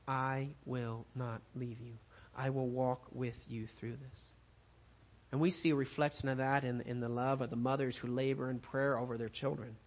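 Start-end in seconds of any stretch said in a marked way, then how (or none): 0.1-4.3 soft, slow promise. Acted. God's promise in the storm.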